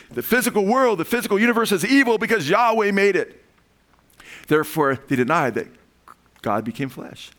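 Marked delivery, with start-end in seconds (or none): 0.1-3.4 high, loud, insistent preaching; driving sermon emphasis; emphatic preaching. Real, not acted. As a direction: hammer the heresy's claim. Preaching peak on heresy.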